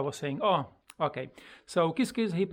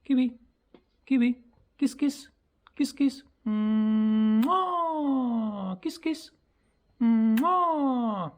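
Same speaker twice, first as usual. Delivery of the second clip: very high, sing-out pet voice with big kiss smacks; teaching the parrot; 9 semitones higher, 5 dB louder, slower than usual. Shown as a demonstration. Owner teaching parrot to say kiss kiss, high cooing pet voice. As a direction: chirp it high to a bird. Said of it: "So I said".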